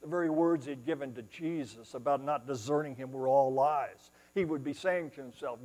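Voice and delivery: ordinary delivery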